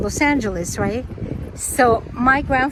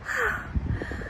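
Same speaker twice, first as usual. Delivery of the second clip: breathless awed sigh; 11 semitones higher, 11 dB quieter than usual. Real, not acted. Awed by the lake.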